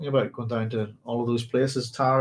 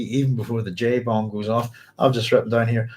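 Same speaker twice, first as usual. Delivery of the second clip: ordinary talk, nothing marked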